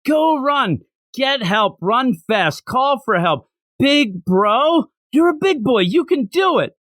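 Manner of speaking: high-pitched, lightly hammed-up pep talk; mock urging. Voicing what big brother should say.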